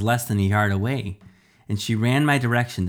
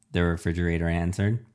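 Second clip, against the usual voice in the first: ordinary delivery, nothing marked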